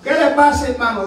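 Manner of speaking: put-on high, loud call of an old lady; high-pitched retold question; old woman calling out. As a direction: call out in concern like an elderly woman. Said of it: "Dijo aquella anciana".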